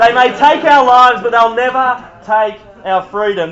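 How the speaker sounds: high, very loud rallying shout; mock war cry. Preacher performing a famous film quote for the room, hammed-up war cry. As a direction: roar it like a film hero.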